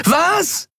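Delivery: very high, piercing yell; screamed question. A narrator's reading. Andriel fails to hear Kai's answer, screams back. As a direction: shout it as if you can't hear. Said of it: "schrie sie".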